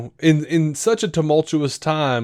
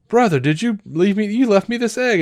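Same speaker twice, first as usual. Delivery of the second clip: puzzled brother; 9 semitones higher than usual. Retold or imitated. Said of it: "he's like".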